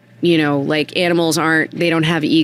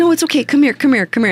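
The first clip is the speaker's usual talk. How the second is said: high, coaxing come here; coaxing the horse; 10 semitones higher, faster than usual. Retold or imitated. Coaxing the horse back, high and soothing. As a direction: gently call the horse over, pitched up. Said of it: "and I'm like".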